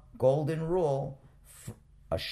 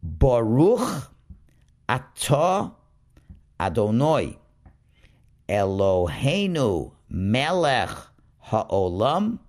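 louder, slow recitation of the whole blessing; full blessing reading; 4 dB louder, slower than usual. Shown as a demonstration. Teacher reciting the full candle blessing before lighting, slow and loud. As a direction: recite the bracha with care, word by word. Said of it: "let's read the entire bracha now".

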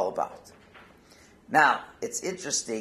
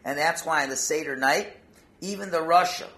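ordinary talk

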